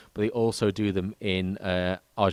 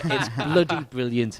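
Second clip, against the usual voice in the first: put-on slow, laughing Welsh accent; 3 semitones higher, slower than usual. Praising Lisbon to a podcast co-host, playful Welsh accent. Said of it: "I just did that in a Welsh accent".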